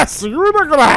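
high, loud burst of amazement; excited shouting. Pretend. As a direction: yell it, thrilled by the ride. 車に乗って興奮し叫ぶ. (興奮して高い声で叫ぶ)

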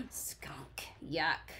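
disgust. Acted. Reacting to skunk in story.

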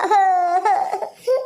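acted sob, breaking out after words; weepy wailing sob; sobbing. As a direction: sob in despair. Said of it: "रडू नको तू".

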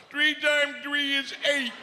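put-on high slow childlike voice. Voicing girlfriend in bed.

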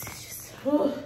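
whispered, worn out, with a sigh of whew; real frustrated whisper trailing into a sigh; exhausted whisper. Real, not acted. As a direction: breathe it out like you're overwhelmed. Overwhelmed, whispering to herself.

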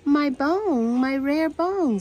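high, loud acted lament; distressed professor. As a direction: wail lightly over a lost treasure. Professor distressed over lost bone.

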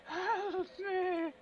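very high, drawn-out squeal; squeaky mock distress call; mock cry for help. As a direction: playfully squeal for rescue, far above your voice. Mock plea for help in game.

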